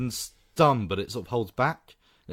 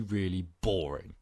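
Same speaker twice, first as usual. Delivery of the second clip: ordinary talk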